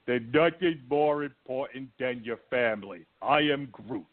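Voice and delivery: mock dim action-hero voice; Dom's gimmick. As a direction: imitate a one-note tough guy reciting his catchphrase. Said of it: "his whole gimmick is".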